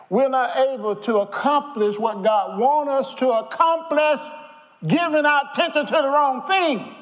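high, loud, driving preacher cry; preaching at full force. Acted. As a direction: press the point home to the church.

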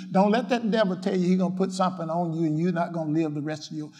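usual speaking voice

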